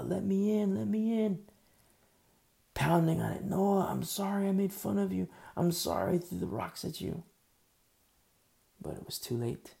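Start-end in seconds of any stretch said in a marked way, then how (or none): 0.0-7.2 higher, louder, pleading to be let in; desperate plea. Acted. Drowning mockers beg Noah at the door, desperate loud pleading. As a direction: beg through the door in a panic.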